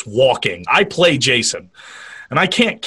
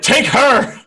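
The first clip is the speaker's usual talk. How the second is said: panicked cowardly cry; 9 semitones higher than usual. Retold or imitated. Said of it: "He's like".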